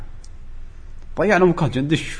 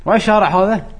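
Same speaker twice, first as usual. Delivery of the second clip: alarmed question; 9 semitones higher, 5 dB louder than usual. Retold or imitated. Lost in strange street.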